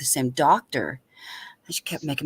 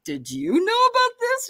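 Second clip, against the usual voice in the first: incredulous question. Retold or imitated. Retold question to sister.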